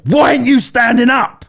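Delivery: high, loud mock scolding; singling someone out. Imitating a singer picking on one audience member, loud and accusing. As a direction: call someone out from the stage, loudly imitated. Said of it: "she singled them out and said".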